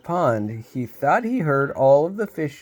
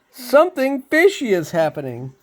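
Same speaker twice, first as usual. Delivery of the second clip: alarmed; 11 semitones higher, 4 dB louder than usual. Acted. Rob alarmed, reporting the fish.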